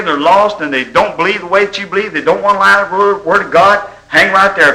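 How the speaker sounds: high, ringing preacher's exhortation; rising revival-meeting fervour; preaching at full force. Real, not acted. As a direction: preach it out over the congregation.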